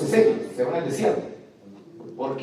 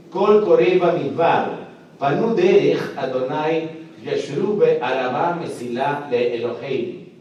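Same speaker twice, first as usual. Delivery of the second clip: lectura pausada del versículo hebreo; slower than usual. Shown as a demonstration. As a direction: recita lento el texto en hebreo. (lectura solemne en hebreo)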